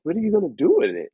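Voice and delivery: high and fast, puzzled; surprised question. Retold or imitated. Asking [name] what he will do with the copy, high and fast. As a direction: toss it off quickly in disbelief. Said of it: "and I'm like".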